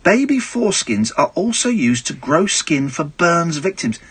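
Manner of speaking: ordinary talk, nothing marked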